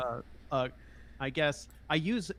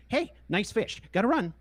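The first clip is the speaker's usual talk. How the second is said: Vander's voice; 9 semitones higher than usual. Retold or imitated. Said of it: "Vander would say".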